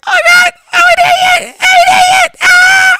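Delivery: very high, loud panicked yelling; mock panicked scream. Host acting out a rider at the top of the climb, screaming in panic. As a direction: shriek like a regretful coaster rider. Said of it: "you all like".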